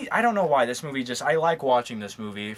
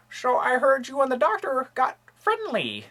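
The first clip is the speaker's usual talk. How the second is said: high put-on villain voice; Gary Oldman villain impression; 11 semitones higher than usual. Fan imitates the villain taunting about the doctor, high and sneering. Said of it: "That was my impression of Manson".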